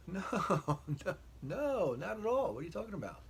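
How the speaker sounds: flustered denial. Retold or imitated. Denying to wife.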